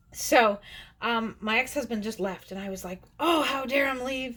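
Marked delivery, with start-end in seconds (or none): 3.2-4.4 high, hammed-up indignant outburst; mock outrage, pitched up; retold anger. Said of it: "I was so angry".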